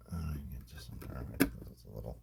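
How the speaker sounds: breathy whispered aside while working. Real, not acted. Guitar tech whispering while fitting the part. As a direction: whisper it under your breath.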